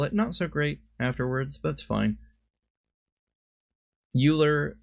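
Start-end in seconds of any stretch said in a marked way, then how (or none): 4.2-4.8 Euler said YOO-ler. Real, not acted. Card game commentator slipping into an old habit, Euler said YOO-ler. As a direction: say Euler starting like "you". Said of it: "I used to call it Euler".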